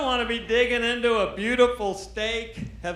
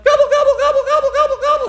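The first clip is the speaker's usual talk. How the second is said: much higher, loud turkey gobbling; turkey gobble; 11 dB louder, much faster than usual. Retold or imitated. Turkey calling to the hunter, high loud gobbling.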